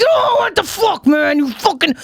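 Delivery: high, slowed outraged mock shout; hammed-up fury at the vendor; mock outrage. Cursing the vendor, a high hammed-up outraged shout. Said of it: "I'm like".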